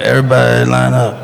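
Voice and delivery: labored instructor in a put-on voice; halting mock fight teacher, stopping and restarting; Steven Seagal. Seagal teaching people how to fight, mock labored instructor.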